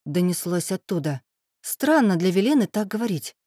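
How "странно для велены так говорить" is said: plain reading voice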